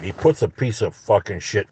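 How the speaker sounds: ordinary delivery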